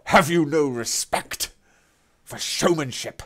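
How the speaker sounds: high, loud theatrical affront; hammed-up mock outrage. Streamer scolds chat for no showmanship, hammed-up outrage. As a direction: play wounded pride for laughs. Repeats line, more hammed.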